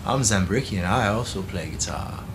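put-on lightly done British accent, playful; re-introduce yourself in a half-hearted British accent; mock British accent. Violinist redoing band introductions, mild mock British accent. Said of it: "give myself an accent for a minute".

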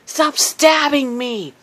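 high, loud pained shout; cartoon pain, shouted high; pained protest. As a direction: yell through the hurt. Being stabbed, protesting.

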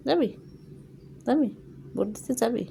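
usual speaking voice

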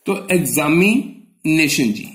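Examination spelled out slowly, syllable by syllable; slow demonstration. Shown as a demonstration. Teacher sounds out the exam word for students, slow. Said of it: "कैसे बना दिया जाता है?".

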